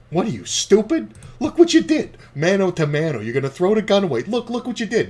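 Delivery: loud, high, mocking jeer; Andrew Dice Clay. Dice ridiculing the villain who tossed his gun, loud high mockery. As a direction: imitate a comedian ridiculing a sucker at full volume. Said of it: "Dice just starts fucking laughing".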